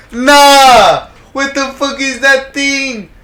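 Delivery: high, loud scared laughter; laugh-scream in disbelief; nervous cackle bursting out; shrieking laugh. Real, not acted. Laughing in fright at monster.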